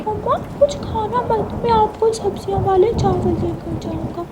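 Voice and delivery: a tiny chick eagerly promising; tiny chirpy child. Acted. Little Mitthu tells his mama not to eat yet, high chirpy child voice.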